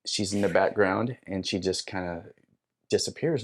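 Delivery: ordinary talk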